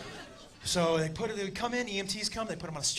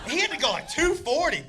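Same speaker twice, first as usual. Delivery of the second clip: normal talking voice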